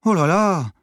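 a secretary put out by the request; grudging oh là là; unenthusiastic. Acted. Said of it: "fit la secrétaire peu enthousiaste".

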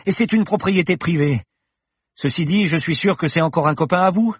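put-on anxious young woman. Paloma demands an explanation from the professor, anxious. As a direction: press him with rising worry.

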